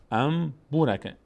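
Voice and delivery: ام بو راکه with a held m, a little slow; n turned to m. Shown as a demonstration. Teacher repeats the model, ام بو راکه with long m. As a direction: hum the m of ام into the b.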